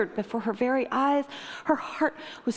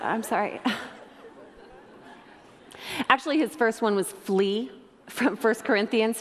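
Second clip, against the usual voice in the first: usual speaking voice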